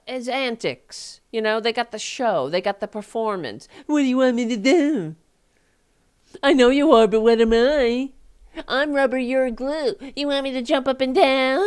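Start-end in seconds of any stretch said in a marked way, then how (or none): 3.9-11.6 higher, louder schoolyard taunting voice; mocking childish taunt. Ranting host mocks opponents with a childish schoolyard taunt. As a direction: sneer it like a playground brat.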